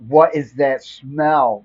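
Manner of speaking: slow, loud, drawn-out disgust; disgusted by smell. Retold or imitated. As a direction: wince at the stink. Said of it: "I hear this".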